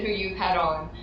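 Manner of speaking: usual speaking voice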